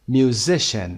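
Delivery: "Musician" with the weight on "si"; second-syllable stress. Shown as a demonstration. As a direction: stress the middle of "Musician": mu-SI-cian. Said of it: "Second syllable". (تکیه روی بخش وسط «Musician»)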